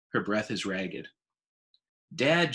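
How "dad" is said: loud, higher call across the room; a girl calling out to her dad. Acted. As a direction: call your father over, urgent. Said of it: "Julia calls".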